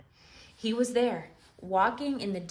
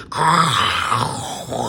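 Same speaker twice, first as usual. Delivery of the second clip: loud cracking sound; thunderous crack; 7 semitones lower, 10 dB louder than usual. Acted. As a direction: make the stone table crack.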